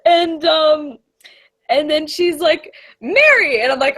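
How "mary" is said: high, loud imitation of a teacher; teacher's scolding call. Teacher catches a pupil giving the finger, scolding shout. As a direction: call the name out sharply, as a teacher would.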